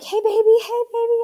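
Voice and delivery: put-on high, loud baby-talk greeting; cooing to baby. Mother retelling how she roused her newborn, cooing. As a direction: coax a stunned newborn in a lifted voice.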